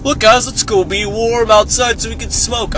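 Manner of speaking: high, loud mock enthusiasm; sarcastic peppy impression of smokers. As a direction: imitate overeager smokers rallying their friends. Mocking what smokers would say.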